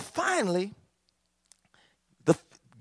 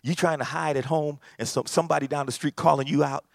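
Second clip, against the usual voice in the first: usual speaking voice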